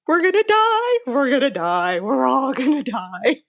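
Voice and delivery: high, frantic repeats of doom, slowing at the end; panicked inner voice. Retold or imitated. Telling the host her terror at the airport, high panicked mock wail. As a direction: replay the panic in your head, a bit hammed-up. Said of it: "is what I'm thinking".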